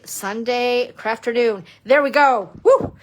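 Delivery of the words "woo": high loud celebratory whoop; woo cheer. Real, not acted. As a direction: whoop for joy. Celebrating success.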